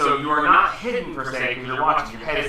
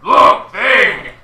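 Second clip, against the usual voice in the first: giant voice; 8 semitones higher, 11 dB louder, much slower than usual. Acted. Giant spots the player.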